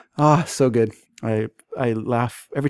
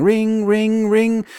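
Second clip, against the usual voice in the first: higher parrot imitation of a phone ring; 9 semitones higher, 3 dB louder, much slower than usual. Parrot mimicking the phone ringing. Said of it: "The parrot's going".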